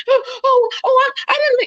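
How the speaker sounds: very high, mock scared stammer; mock frightened. Mimicking the frightened coworker, mock scared and high.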